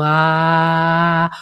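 low, open "ah" from the chest; heart-centred ah. Shown as a demonstration. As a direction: let a deep "ah" come from the heart. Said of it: "Una A realmente de corazón".

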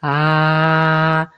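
low held "Ah"; heart-centred ah. Shown as a demonstration. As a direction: feel the "Ah" in your chest. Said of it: "tiene que ver con el chakra corazón".

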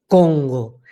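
"Congo" low and held, placed at the back palate; voz atrás, en el paladar. Shown as a demonstration. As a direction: say "Congo" from far back in the mouth, slowly falling. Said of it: "ahí detrás por el paladar". ("Congo" grave, resonando detrás)

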